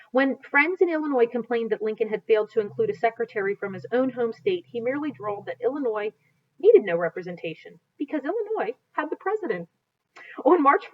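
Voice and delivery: reading aloud from a history book, steady and clear; storybook narration. Acted. A teacher reading a Lincoln history aloud to students, in narration voice.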